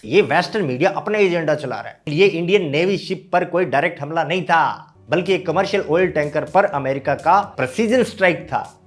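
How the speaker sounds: ordinary talk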